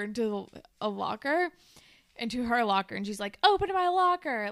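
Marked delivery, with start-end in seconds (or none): 3.4-4.5 higher, louder order; a touch of angry teenage demand. Acted. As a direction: snap it like a command you won't repeat. Emma demanding from Peter.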